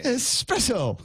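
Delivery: a lightly voiced snob, slow and haughty; snooty snob voice. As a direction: sniff at the hoi polloi like a hammed-up aristocrat. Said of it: "when you say it like that".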